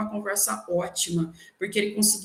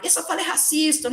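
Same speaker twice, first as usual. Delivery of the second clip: mock finger-pointing, pitched up; higher, imitated accusation; accusing; 7 semitones higher than usual. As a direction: call it out like an accuser. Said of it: "pela visão de apontar".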